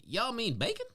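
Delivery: high-pitched imitated Southern question; Southern accent. Repeating the employee's line for years, high Southern accent. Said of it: "I love that Southern accent".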